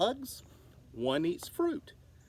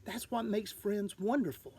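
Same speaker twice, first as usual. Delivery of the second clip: ordinary delivery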